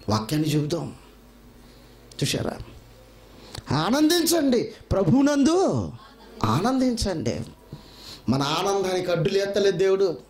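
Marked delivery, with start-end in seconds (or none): 3.8-7.4 fervent exhortation. Acted. Preacher exhorting congregation.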